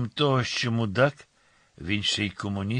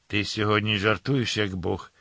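usual speaking voice

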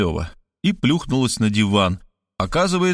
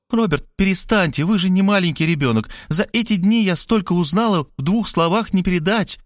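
higher, anxious rush of warnings; frightened pleading; 7 semitones higher than usual. A narrator's reading. Markhaleva frightened that Robert ends the talk, higher anxious pleading. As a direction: urge him, half panicked, to see the danger. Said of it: "Мархалёва испугалась".

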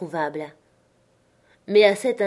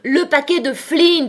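high, loud impatient shout; exasperated raised-voice demand; angry correction; 8 semitones higher, 6 dB louder than usual. A narrator's reading. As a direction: shout down his answer in irritation. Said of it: "l'aveugle envoya l'argent au diable".

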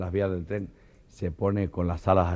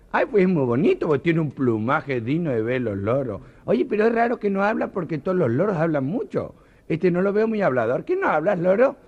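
gushing party lady; 9 semitones higher, 5 dB louder than usual. Acted. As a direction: coo over the parrot like a society lady. Guests question the silent parrot.

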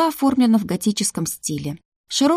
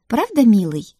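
higher, honeyed teasing question; sly; 4 semitones higher, 4 dB louder than usual. A narrator's reading. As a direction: coax slyly, pitch lifted. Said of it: "Хитро протянула она". (хитро, повыше)

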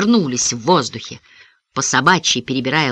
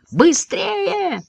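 high-pitched urging shout; excited cry; 13 semitones higher than usual. A narrator's reading. Johnny urging the running boys faster, excited high cry. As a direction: cry out to spur them on. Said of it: "выкрикнул Джонни".

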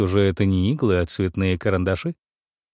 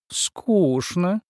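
put-on high whining child; whiny little girl; 9 semitones higher than usual. A narrator's reading. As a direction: whine it like a bored girl. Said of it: "захныкала".

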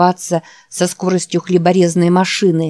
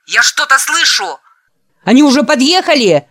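high, louder panicked call; almost yell in alarm; near shouting; 8 semitones higher, 4 dB louder than usual. A narrator's reading. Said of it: "Санни почти кричал". (почти крик в панике)

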